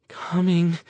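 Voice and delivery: high, scared warning. Acted. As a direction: breathe it out in fear. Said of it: "She sounded scared".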